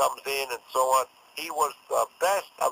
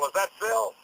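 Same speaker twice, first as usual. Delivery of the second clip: ordinary delivery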